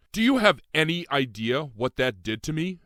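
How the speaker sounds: high, loud anguished shout; sudden shout. A narrator's reading. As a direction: fling it at him in sudden anguish. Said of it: "I shouted suddenly".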